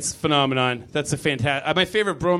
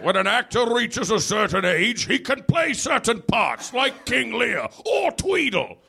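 slow, higher, grand British stage delivery; thespian voice; 6 semitones higher than usual. Retold or imitated. Still imitating old British actors, grandly. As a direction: keep the pompous old actor going.

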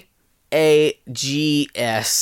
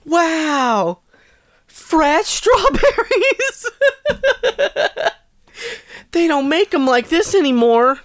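put-on high, very slow, laughing kid voice; giggling child amazed at breakfast; delighted kid; 13 semitones higher, slower than usual.

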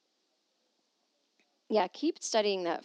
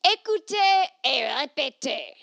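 louder, slow teacher command; French teacher; 6 semitones higher, 8 dB louder, slower than usual. Pretend. Listener's letter quoting a French teacher.

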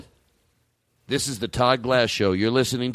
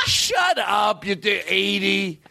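higher, shouted "Shut up", then slower; mock shout; 7 semitones higher, much slower than usual. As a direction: yell it at the old man for real. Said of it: "you tell an eighty-year-old".

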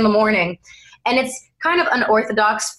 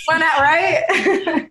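slow, high laughing reply; 8 semitones higher, much slower than usual. Real, not acted. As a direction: laugh through the question. Laughing at host's joke.

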